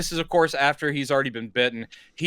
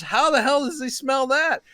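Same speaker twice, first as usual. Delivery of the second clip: much higher, strained voice of a baffled coworker; 10 semitones higher than usual. Retold or imitated. A coworker on the phone, baffled that Will smelled the vodka, voice pitched way up. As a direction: pitch it way up in disbelief.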